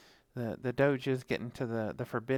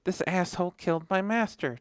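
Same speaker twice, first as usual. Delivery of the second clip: upset dog voice; 5 semitones higher than usual. Retold or imitated. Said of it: "she's like".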